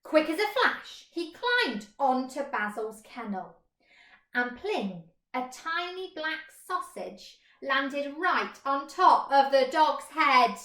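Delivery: plain reading voice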